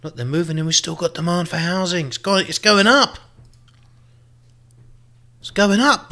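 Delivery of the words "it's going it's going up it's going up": excited cheer. Real, not acted. Cheering rising demand.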